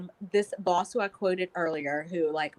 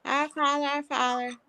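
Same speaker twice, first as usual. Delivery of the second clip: put-on higher, eager child's chant, getting louder at the end; playful toddler prayer imitation; imitating toddler praying; 5 semitones higher, 5 dB louder than usual. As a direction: chant it over and over like an excited toddler. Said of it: "every time she starts to, she goes".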